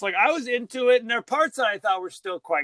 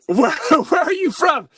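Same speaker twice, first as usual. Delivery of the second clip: put-on shouted, laughing French accent, much higher; a loud, laughing attempt at a French accent that falls apart; hammed-up French accent; 8 semitones higher, 8 dB louder than usual. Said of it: "I can't even do the French accent".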